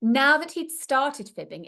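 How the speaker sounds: usual speaking voice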